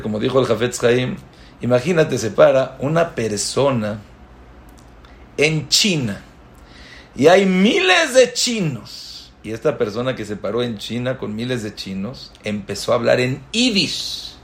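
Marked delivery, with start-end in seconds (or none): 5.4-9.3 asombro exagerado. Acted. Imaginando a un hombre en China.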